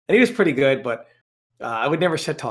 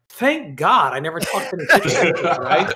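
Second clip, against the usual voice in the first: laughing; 5 semitones higher, 5 dB louder, slower than usual. Retold or imitated. Punchline of his tournament story.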